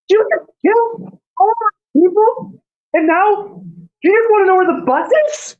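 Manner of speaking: very high, incredulous shriek; screaming. Acted. Helen screams at those who killed her people, shrieking. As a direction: scream it in outraged disbelief, far above your own pitch. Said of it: "and she just screams".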